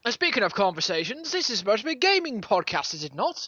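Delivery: hammed-up posh old chap, swooping pitch; mock old-fashioned gentleman. Host lectures friends on Twitter manners, mock old gentleman. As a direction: play a fusty gent reminiscing about his day. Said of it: "I don't know why I'm talking like this anymore".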